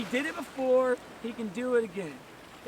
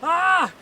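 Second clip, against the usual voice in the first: a sudden loud yelp of pain, pitched up; mock pained yelp; 6 semitones higher, 10 dB louder than usual. Reacting to a paper cut with a loud pained yelp.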